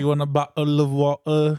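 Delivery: hammed-up English accent. Shown as a demonstration. Repeating their one English line, mock English accent. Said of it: "in an English accent".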